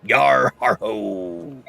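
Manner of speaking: pirate voice. Acted. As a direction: drawl it like a shifty old sea dog. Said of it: "It's not my best work".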